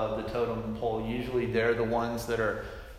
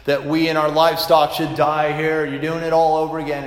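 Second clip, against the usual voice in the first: raised, higher voice, the people complaining bitterly; quarrelsome complaint; 3 semitones higher, 9 dB louder than usual. A narrator's reading. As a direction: read it as an angry crowd accusing Moses. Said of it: "They quarreled with Moses and said".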